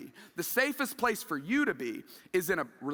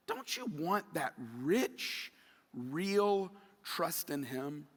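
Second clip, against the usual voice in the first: slow, hushed and lower appeal; tender, quiet invitation to trust; soft intimate plea; 5 semitones lower, 7 dB quieter, much slower than usual. Real, not acted. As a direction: draw them in gently and slowly.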